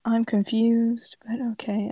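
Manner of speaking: real puzzled whisper; whispered aside. Real, not acted. Puzzled aside about the text.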